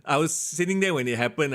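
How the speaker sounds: ordinary talk, nothing marked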